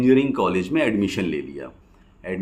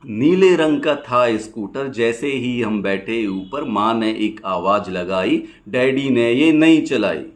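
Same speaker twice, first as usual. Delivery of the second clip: rhythmic rhymed verse, pausing after each line; poem recitation; 3 semitones higher, 6 dB louder than usual. Acted. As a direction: recite it as lilting stage poetry.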